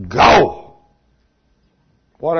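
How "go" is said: loud single-word order; one word, absolute authority; command. A narrator's reading. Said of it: "he said unto them".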